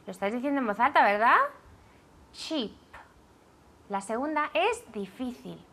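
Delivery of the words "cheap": Cheap with a hard ch and a final p that lets the air out; teaching pronunciation. Shown as a demonstration. Teacher to class, modelling Cheap with an aspirated final p. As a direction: say Cheap, ch at the start, puff of air on the p. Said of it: "Tiene que salir aire".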